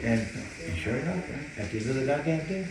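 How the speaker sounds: ordinary talk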